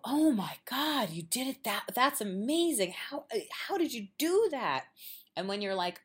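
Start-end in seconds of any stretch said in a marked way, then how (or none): 0.0-4.9 higher, drawn-out amazed gushing; gushing admiration. Retold or imitated. Fellow quilter praising her technique, gushing amazement. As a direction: play a fellow quilter blown away. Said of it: "They go".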